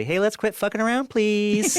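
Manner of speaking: high, loud sing-song fake-sweet plea; sugar-coat the order in a high-pitched voice; mock-loving nudge. Film crew boss nudging people back on track, falsely loving. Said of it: "I just lovingly say".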